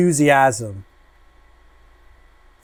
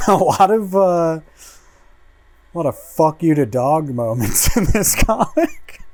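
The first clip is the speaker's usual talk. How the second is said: laughing through the words, high; 5 semitones higher, 5 dB louder than usual. Real, not acted. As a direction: giggle while you say it. Laughing at comic moments.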